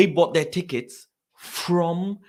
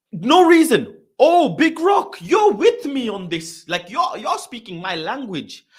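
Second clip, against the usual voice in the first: excited agreement; 10 semitones higher, 8 dB louder than usual. Real, not acted. Streamer greets viewer who shares his grievance, excited. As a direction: cry out that someone finally understands.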